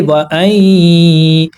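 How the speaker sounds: वअई slow, the nasal sound held briefly in the nose; nasal ghunna. Shown as a demonstration. Teacher showing students ghunna on वअई, slow and nasal. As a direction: hold वअई's nasal ghunna slowly. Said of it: "नाक में थोड़ी देर आवाज़ रोकने को गुन्ना कहते हैं".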